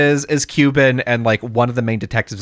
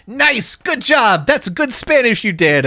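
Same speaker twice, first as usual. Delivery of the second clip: high, drawn-out mock congratulations; mock praise; 8 semitones higher, slower than usual. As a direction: praise them in an exaggerated high voice. Acting coworker's reply to wrong language.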